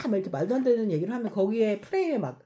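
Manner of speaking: ordinary talk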